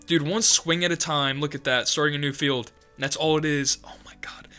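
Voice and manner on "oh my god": hushed awe; whisper. Real, not acted. Reactor whispering to viewers in awe. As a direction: breathe it out in wonder.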